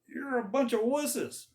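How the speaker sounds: high, jeering taunt; mock the army like a bully giant. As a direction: giant yelling insults across the valley. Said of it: "He'd say".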